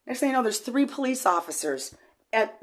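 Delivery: normal talking voice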